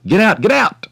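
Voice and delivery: high, loud, forceful order; angry driving out. A narrator's reading. Gardener driving the woman out of the garden, angry shout. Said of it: "he drove her out".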